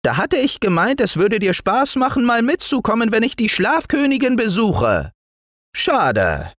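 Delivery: high piping voice sinking to a sigh of pity; tiny gnome, disappointed; regretful little dwarf. Acted. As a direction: dangle the lost treat, then drop on the last word. Dwarf figure lamenting the lost trip.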